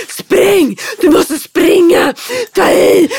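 put-on loud, high yelling to the child; shouting. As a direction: yell up at him to run. Mother shouting up at son. (mamman ropar högt åt sonen att springa)

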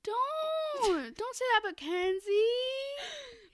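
high, pleading cry in mock distress; mock outrage. Begging her cohost not to insult Kenzie, in a high mock-wounded cry. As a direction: defend her like a hurt fan. Defending a beloved character.

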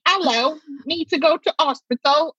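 a weak attempt at a British accent; imitating Russell Brand's British accent, barely; bad British accent. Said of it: "I can't do a British accent".

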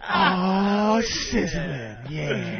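a lightly voiced jazzy crooner. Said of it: "make it jazzy".

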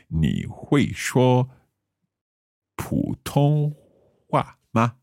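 slow, careful learner's Mandarin. Shown as a demonstration. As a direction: sound out each syllable of 普通话 with its tones. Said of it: "or I could say".

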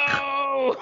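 high, cracking mock wail of despair; mock anguished cry. Paul opening his wallet, pretend anguished cry. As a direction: cry out as if your wallet was robbed. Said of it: "opened his wallet and was like".

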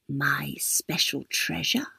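slow hushed whisper; old lady hushing her secret, slow and whispered; secretive whisper. A narrator's reading. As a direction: savor the secret slowly.

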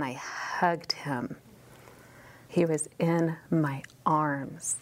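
awed whisper as John; tender whisper. Acted. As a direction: let the memory hush you. Voicing John's tender memory.

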